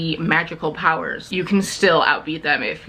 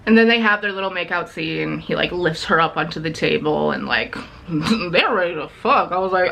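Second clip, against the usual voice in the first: normal talking voice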